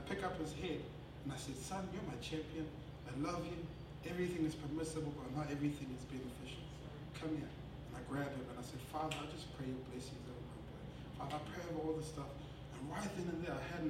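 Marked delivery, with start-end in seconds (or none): 1.6-7.5 low, soft fatherly comfort; gently reassure, keeping it hushed; tender reassurance. Retold or imitated. Father forgiving his crying son, tender reassurance. 8.9-12.3 low, very soft earnest prayer; hushed prayer. Retold or imitated. Father praying blessing over his boy, hushed prayer.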